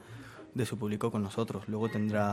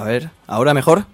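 raised voice over a bad line; loud; 4 semitones higher, 15 dB louder than usual. Real, not acted. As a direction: call out louder down the phone. Calling into bad phone line.

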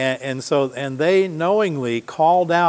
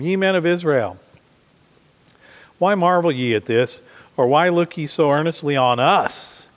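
a raised, declaiming Bible reading; scripture reading; 4 dB louder than usual. Acted. Preacher reading Peter's words to the congregation, declaiming. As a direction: proclaim the verse to the congregation.

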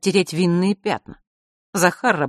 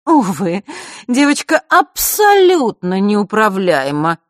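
loud, slow, raised-pitch disdain; loud haughty woman; 6 semitones higher, 7 dB louder, slower than usual. A narrator's reading. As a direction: announce it to the whole room. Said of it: "громкий голос Елены". (громкая надменная дама, говорит на весь зал)

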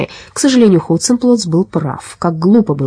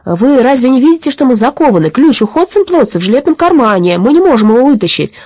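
higher-pitched, raised insistent voice; urgent young captive; 7 semitones higher, 4 dB louder than usual. Acted. Chained boy explaining the key to policeman, urgent. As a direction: protest urgently at the obvious.

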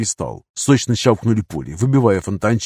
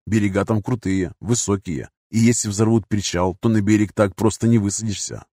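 ordinary delivery, nothing marked

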